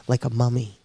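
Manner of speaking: usual speaking voice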